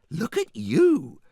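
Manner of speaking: high, slow delighted squeal; loud drawn-out gush; admiring cry. A narrator's reading.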